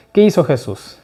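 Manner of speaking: ordinary talk, nothing marked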